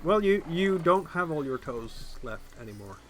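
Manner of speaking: ordinary talk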